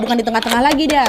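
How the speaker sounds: ordinary delivery, nothing marked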